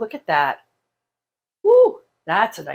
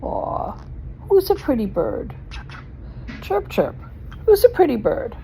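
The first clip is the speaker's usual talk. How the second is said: teaching a parrot; 7 semitones higher than usual. Real, not acted. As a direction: coo at the parrot, pitched up. Talking to the pet bird.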